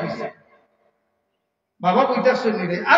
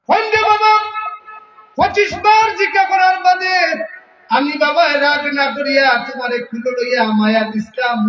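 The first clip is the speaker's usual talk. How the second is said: fiery preaching; 4 semitones higher than usual. Acted. Preacher voicing the father's hurt at his son at full force. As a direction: voice the old father's diary as a fiery sermon.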